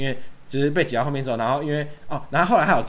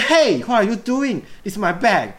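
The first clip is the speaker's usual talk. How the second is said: high, louder mock outrage; angry complaint; 10 semitones higher, 7 dB louder, much slower than usual. Rude guy scolding them over his bag, mock angry. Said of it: "他说".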